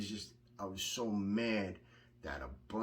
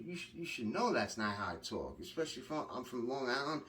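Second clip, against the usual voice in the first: ordinary delivery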